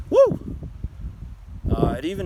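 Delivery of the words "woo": high loud whoop of excitement; excited whoop. Real, not acted. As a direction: let out a joyful woo. Excited outburst.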